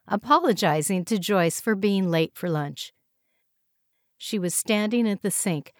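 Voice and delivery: usual speaking voice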